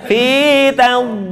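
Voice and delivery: FITADLIL very slow, the d held and muffled; lengthened dad. Shown as a demonstration. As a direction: stretch through the D of FITADLIL without a break. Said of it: "disebut dengan istitolah". ("Fitadlil" dipanjangkan, d empuk)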